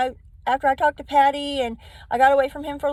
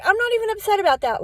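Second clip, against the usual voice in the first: agitated husband; 9 semitones higher, 3 dB louder, faster than usual. Retold or imitated. Husband arguing with the police.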